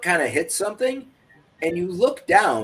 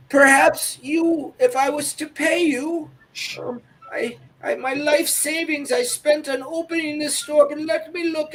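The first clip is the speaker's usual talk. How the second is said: put-on old lady voice; 12 semitones higher than usual. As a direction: plead gently like a poor old shopkeeper. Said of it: "He said".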